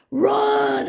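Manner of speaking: high loud yell of Run; panicked scream to run. A narrator's reading. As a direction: shriek it in panic. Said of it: "I screamed to myself".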